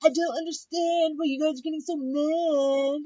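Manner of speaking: imitating a roommate acting innocent; mock whiny Jamie. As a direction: play dumb like you don't get it. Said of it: "she was like".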